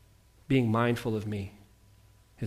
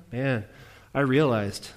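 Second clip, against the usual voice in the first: ordinary delivery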